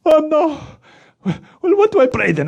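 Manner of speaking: high fretting puppet voice; worried puppet. Acted. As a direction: puppet fretting over the prayer.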